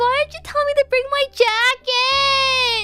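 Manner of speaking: high, loud, slow whiny complaining; whiny girlfriend. Pretend. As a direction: whine about the cold like a spoiled girlfriend.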